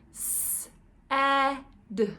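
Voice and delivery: "S, eh, d" as isolated phonemes with gaps; sounding out. Shown as a demonstration. Teacher models reading the word together, sounding out "S, eh, d". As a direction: sound out "S, eh, d" one separate sound at a time. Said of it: "let's try reading the word together".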